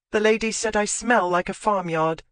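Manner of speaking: put-on a touch of a girl's voice repeating a complaint; young lady quoted. A narrator's reading. Olivia relaying the ladies' teasing, lightly voiced. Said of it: "she'd said".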